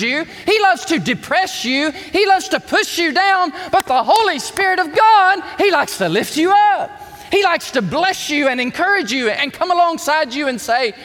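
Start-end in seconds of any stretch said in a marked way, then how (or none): 3.7-9.3 louder, rising preacher's call; fiery preaching. Real, not acted. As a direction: lift the crowd with the promise. Preaching at full force.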